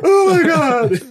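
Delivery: high, loud mock wail; over-the-top wailed outburst; cry mode. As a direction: burst into a hammed-up sob. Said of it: "push the button on my chest for cry mode".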